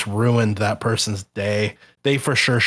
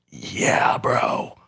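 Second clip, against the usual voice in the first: whispering praise to a teammate; genuine praise; slower than usual. Retold or imitated. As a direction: breathe it out like heartfelt hype. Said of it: "nothing feels better than a genuine".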